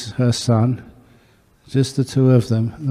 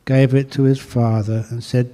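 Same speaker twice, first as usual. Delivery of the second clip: ordinary talk, nothing marked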